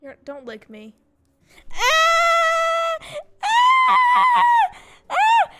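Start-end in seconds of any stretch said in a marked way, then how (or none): 1.7-5.5 shrieking while being tickled. Real, not acted. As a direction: squeal from being tickled, loud and high. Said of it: "He just tickled me".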